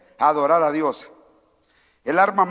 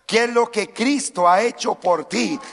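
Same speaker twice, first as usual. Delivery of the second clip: ordinary delivery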